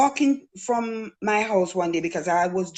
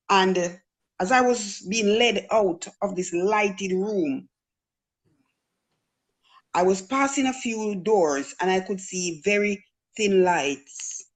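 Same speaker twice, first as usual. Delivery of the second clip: ordinary talk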